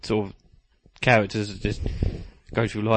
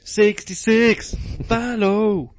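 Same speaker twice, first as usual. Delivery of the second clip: high, stretched-out hero chant; playful superhero jingle; cartoon hero theme; 9 semitones higher, slower than usual.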